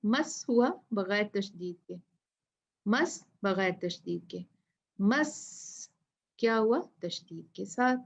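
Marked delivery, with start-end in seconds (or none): none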